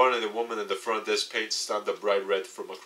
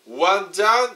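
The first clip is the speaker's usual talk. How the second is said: high, bright relief; relieved cheer; 11 semitones higher, 6 dB louder, slower than usual. Real, not acted. Narrator relieved after leaving the shop, excited. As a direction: pump yourself up after escaping.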